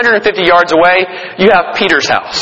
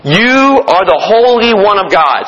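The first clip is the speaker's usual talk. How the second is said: slow, drawn-out demon voice; demon's outcry; 3 semitones higher, slower than usual. Acted. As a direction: stretch each word out slowly, calling out as the demon. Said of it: "And the demon says".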